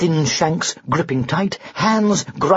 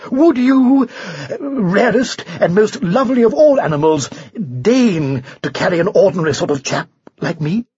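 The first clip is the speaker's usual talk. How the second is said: raised, effusive knightly plea; beseeching knight; 5 semitones higher, 4 dB louder than usual. Acted. Knight asks the unicorn to carry him, fulsomely beseeching. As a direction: start high and loud, then turn humble.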